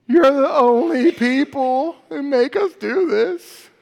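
put-on high, loud, slow drawn-out teen complaint; whiny teenagers. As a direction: whine it like kids losing their phones. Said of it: "they would just lament".

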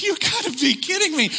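high, exasperated disbelief; can't believe this is the rescue; mock exasperation. Inner disbelief.

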